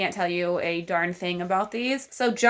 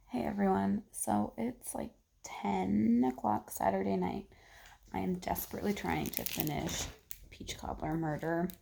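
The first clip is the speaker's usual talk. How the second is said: hushed late-night voice; 8 dB quieter than usual. Real, not acted. Reader lying down at night updating viewers, hushed. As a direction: talk softly, as if close to falling asleep.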